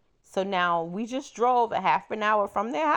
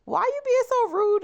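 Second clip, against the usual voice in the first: much higher, louder offended cry; indignant; 4 dB louder than usual. Retold or imitated. Scolding the rude friend in the car, high offended squeal. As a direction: call out his rudeness in a high squeal. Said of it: "And I'm like".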